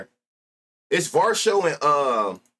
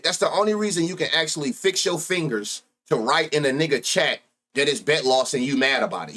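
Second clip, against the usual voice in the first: usual speaking voice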